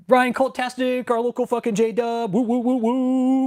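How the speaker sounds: high, loud, slow sing-song greeting; excited shout-out. Real, not acted. Streamer greeting viewer Ryan in chat, excited sing-song. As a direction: hype up the viewer's name.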